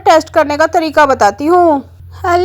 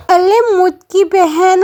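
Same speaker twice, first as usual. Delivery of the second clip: ordinary delivery, nothing marked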